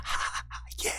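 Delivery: very soft, breath-only mock celebration; hushed approval. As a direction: imitate a buddy's whispered cheer. Buddy's secret approval, retold.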